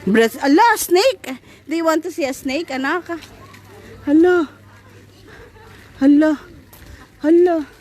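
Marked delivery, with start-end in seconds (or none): 4.1-7.7 excited gasp. Real, not acted. Mother marvels at snake.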